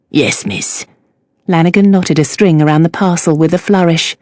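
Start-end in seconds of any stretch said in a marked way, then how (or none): 0.1-0.8 low deferential Cockney voice; humble Cockney fishmonger; Cockney accent. A narrator's reading.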